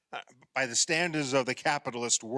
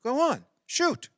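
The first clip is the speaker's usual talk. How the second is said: eager urging; 11 semitones higher, 4 dB louder than usual. Acted. Urging wife to shoot.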